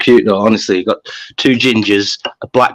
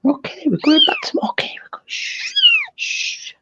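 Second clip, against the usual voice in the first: hushed soothing; 3 dB quieter than usual. Real, not acted. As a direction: whisper and shush a little one back to Mum. Hushing a baby animal to Mum.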